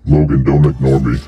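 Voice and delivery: normal talking voice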